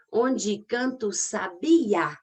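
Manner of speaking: Onde canto sabiá stretched out slowly; slow syllable count. Shown as a demonstration. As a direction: tick off the beats. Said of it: "aí eu já conto o A".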